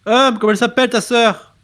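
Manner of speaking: loud, high shout; shouting through a door. Acted. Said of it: "Je te crie à travers la porte".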